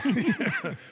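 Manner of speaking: high burst of laughter; high bright laugh. Real, not acted. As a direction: laugh out loud at the joke. Laughing at metaphor joke.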